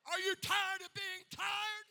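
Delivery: ringing pulpit shout; shouted challenge. Real, not acted. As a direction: call out the question to the crowd. Shouted question to congregation.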